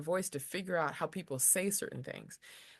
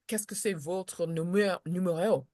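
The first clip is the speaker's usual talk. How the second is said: French inflection. Shown as a demonstration. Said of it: "It has a deeper sound to it".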